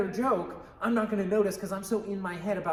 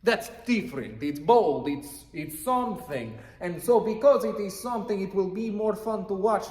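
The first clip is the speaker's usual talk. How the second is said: speaking in a put-on Russian accent; hammed-up thick Russian accent. Shown as a demonstration. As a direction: lay on a heavy stage Russian accent. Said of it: "I have thick Russian accent and I speak like this".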